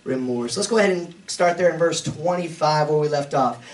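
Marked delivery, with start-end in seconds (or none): none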